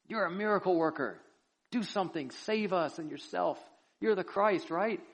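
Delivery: high-pitched taunting plea; goading. Acted. Criminal trying to spark something in Jesus, high-pitched goading. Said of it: "He was trying to spark something in him".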